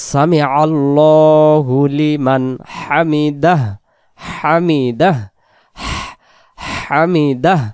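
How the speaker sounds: হামিদাহ ending on a breathy final হ, drawn out; slow recitation demo. Shown as a demonstration. Teacher demonstrating the final h of হামিদাহ slowly. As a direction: stretch সামি আল্লাহু লিমান হামিদাহ. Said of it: "দাহ, দাহ হবে".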